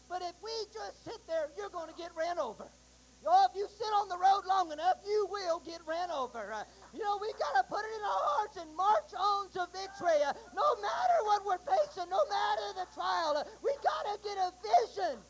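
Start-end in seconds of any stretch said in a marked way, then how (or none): 3.2-15.2 full-force preaching. Acted. Preacher urging the congregation to hang on, shouting. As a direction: lift the congregation with hope, crying out.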